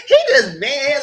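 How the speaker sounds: high, loud voice near tears; crying laugh. Real, not acted. Viewer still shaken by the fight, high and teary. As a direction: squeeze it out half crying.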